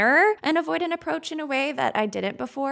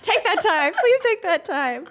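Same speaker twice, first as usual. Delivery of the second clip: laughing plea; 7 semitones higher, 4 dB louder than usual. Real, not acted. Laughing echo of punchline.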